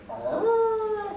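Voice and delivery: long, high dog whine; sustained high imitation of a dog; imitated dog noise. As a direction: let out a rising mock howl. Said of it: "and then he went".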